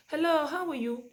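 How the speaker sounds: put-on bright high-pitched, loud hello to a child; greeting a child. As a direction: call out a greeting to get a child's attention.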